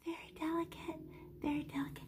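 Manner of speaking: soft unvoiced whisper. Shown as a demonstration. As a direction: whisper it as delicately as the brush stroke. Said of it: "How soft do I push".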